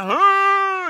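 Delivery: sudden loud, high bark of a laugh-grunt; loud boisterous grunt. Retold or imitated. Drunk passenger blurting loudly across the plane rows, loud and high. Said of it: "just loud and boisterous".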